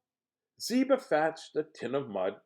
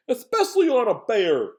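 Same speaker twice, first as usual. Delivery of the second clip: Moose's voice; 8 dB louder than usual. Acted. Moose pitches antlers to the others, loud. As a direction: boom out the Moose's idea. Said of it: "Moose complained".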